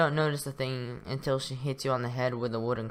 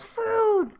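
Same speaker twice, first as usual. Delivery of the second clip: high squeaky yell of "Food!"; 4 dB louder than usual. Acted. Pirate yelling at the turkey, high shout. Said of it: "he points to you and yells".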